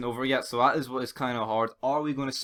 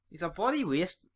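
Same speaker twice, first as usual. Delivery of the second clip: ordinary talk, nothing marked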